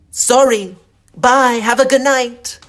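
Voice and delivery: high, loud, embarrassed goodbye; hammed-up awkward shouted exit; panicked retreat. Hurried retreat after yelled proposition.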